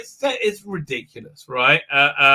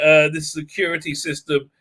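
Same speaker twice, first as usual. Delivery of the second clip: normal talking voice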